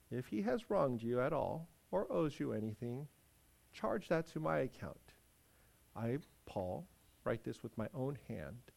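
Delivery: ordinary delivery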